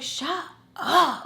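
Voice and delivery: high, worked-up retold shout; exasperated. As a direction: burst out as if at the end of your patience. Retold exasperated reaction to cursing.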